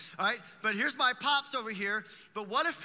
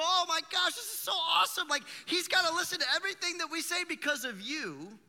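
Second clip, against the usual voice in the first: much higher and louder, gushing excitement; thrilled disciples; 9 semitones higher, 5 dB louder than usual. Retold or imitated. Disciples telling Jesus the demons obey them, overjoyed. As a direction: burst out like you can't believe it. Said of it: "the disciples came back and go".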